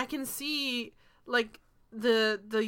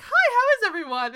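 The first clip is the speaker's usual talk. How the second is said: high, loud oblivious greeting; sweetly unsuspecting, pitched way up; innocent Richard; 10 semitones higher, 8 dB louder than usual. Retold or imitated. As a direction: imitate a clueless dad walking in. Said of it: "poor Richard just, like, walked into a room".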